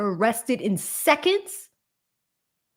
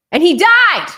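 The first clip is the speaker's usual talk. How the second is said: sudden loud, higher blurt; abrupt loud outburst; 9 semitones higher, 10 dB louder than usual. Real, not acted. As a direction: blurt it out too loud and high. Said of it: "that was abrupt. I apologize".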